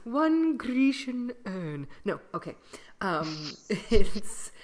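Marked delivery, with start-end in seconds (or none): 0.0-1.9 high, loud and slow, grandly declaimed; mock theatrical pose. Joking about stage movement, slow grand declaiming. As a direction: announce it like a pompous stage number.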